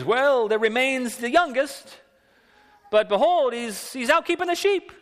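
put-on Yiddish accent. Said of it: "the biblical characters with those accents".

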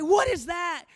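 high, loud shout of alarm; alarmed cry. Acted. Disciples in the boat see a figure, alarmed shout. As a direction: call out as if startled.

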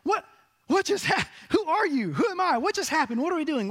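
high, incredulous what-just-happened; stunned disbelief. Retold or imitated. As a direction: pitch jumps high, baffled questions tumbling out. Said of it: "And you're like".

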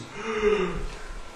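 soft acted gasp; demonstrated shocked gasp. Hushed crowd hears Jesus forgive sins, acted gasp. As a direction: act out a crowd's quiet gasp. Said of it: "or maybe one of these".

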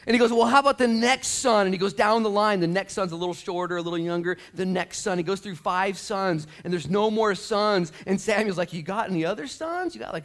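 high, loud, driving preacher voice; full-force preaching. Real, not acted. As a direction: pile up the list with rising force.

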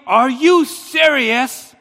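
re-enacted angry shout, slow and loud; high, loud, frustrated outburst; screaming and yelling. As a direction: yell it in disbelief, stretching the words. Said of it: "screaming and yelling at the T".

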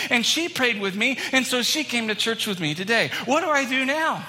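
high, breathless-to-tell imitation of an eager volunteer; bounding excitement. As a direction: pour out the good news, pitch leaping on each big point. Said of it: "comes bounding up to me".

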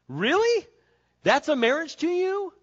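high, loud mock disbelief; incredulous. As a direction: scoff at the idea.

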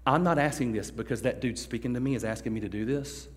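quiet earnest prayer; sincere prayer. Shown as a demonstration. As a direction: pray it like you truly mean it. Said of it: "pray a prayer to God with a full heart".

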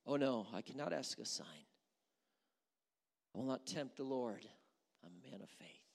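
low, hushed, falsely holy voice; mock piety. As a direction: sneer at his false humility. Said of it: "stands before God and says".

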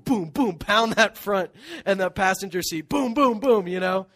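loud, punchy booms imitating mom's foot stomping; mock brake pounding. Mom's invisible brake in the passenger seat, loud booming imitation. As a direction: stomp out each boom like hitting the floorboard.

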